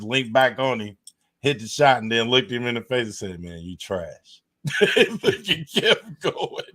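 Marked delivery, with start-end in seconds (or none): 3.4-4.4 low, quiet, slow flat-voiced jab; deadpan put-down. Quoting Duncan's deadpan insult. 4.6-6.6 high and slow, laughing through the words. Real, not acted.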